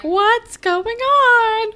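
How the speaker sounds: high, slow, stunned question; drawn-out disbelief; baffled Anya. Retold or imitated. Anya seeing the limo, high and slow. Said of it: "she's like".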